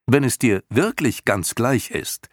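put-on high, loud, insistent young voice; boy pleading insistently with the old creature; urging boy. A narrator's reading. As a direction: press her hard. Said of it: "drang Atreo in sie".

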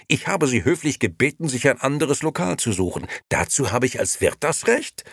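high, loud self-justification; defensive. A narrator's reading. Nino justifies himself as landlord, loudly defensive. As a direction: insist on being in the right. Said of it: "verteidigte sich Nino".

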